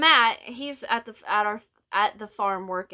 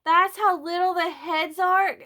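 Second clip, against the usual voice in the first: incredulous; 9 semitones higher, 5 dB louder than usual. Retold or imitated. Shocked at tiny doll heads.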